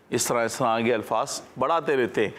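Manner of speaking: ordinary delivery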